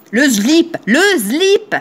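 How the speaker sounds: "slip" said as "zlip", teasingly repeated; mocked regional "zlip". Getting back at those who mock her accent, "zlip". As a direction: buzz the S of "slip" into Z, climbing on the repeat. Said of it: "vous dites un slip".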